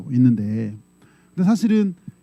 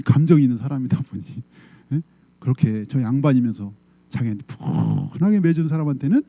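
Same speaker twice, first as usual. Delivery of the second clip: ordinary delivery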